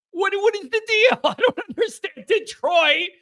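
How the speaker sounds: high-pitched, laughing disbelief; amused bafflement. Real, not acted. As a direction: puzzle aloud, laughing, pitch climbing. Baffled by caller's accent.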